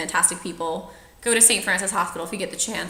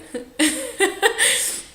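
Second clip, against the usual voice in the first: laughter; 13 semitones higher, 4 dB louder than usual. Real, not acted. Laughing at own story.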